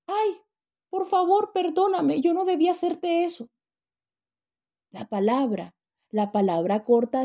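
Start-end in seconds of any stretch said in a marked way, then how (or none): 0.1-3.5 voz aguda de niña pidiendo perdón; niña arrepentida. Retold or imitated. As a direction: imita a una niña que se disculpa. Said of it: "Salió la otra". (niña arrepentida, voz aguda y suplicante)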